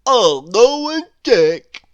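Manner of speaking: very loud, slow, dopey declaration; Mister Dizzy voice. A narrator's reading. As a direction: bellow it slowly like a silly fellow. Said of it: "said Mister Dizzy".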